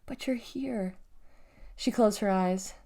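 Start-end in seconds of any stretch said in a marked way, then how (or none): 0.1-1.0 soft, hushed relief; whispered. A narrator's reading. Girl greets the long-awaited arrival, whispered. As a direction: say it softly, almost to yourself. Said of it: "she whispered".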